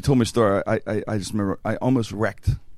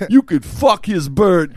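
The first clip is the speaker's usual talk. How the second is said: put-on slow, loud, high old man, every word drawn out; old guy voice; 8 semitones higher, 7 dB louder, slower than usual. Fake IRS agent repeating it to the stunned client, slow old guy. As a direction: imitate an old official repeating it deliberately. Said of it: "He does this old guy voice".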